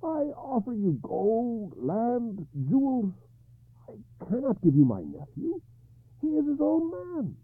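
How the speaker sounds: high, strained, reasoning king; trying to be reasonable. Acted. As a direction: plead calmly through panic. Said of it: "he tried to speak reasonably".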